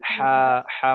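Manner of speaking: হা drawn from inside the throat; throaty big ha. Shown as a demonstration. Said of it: "গলার ভিতর থেকে হবে".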